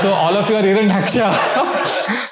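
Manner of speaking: laughing, calling out the punchline; slow, gleeful laughing jab. Retold or imitated. Teacher teasing the gold-buying audience, laughing. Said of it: "So he's laughing".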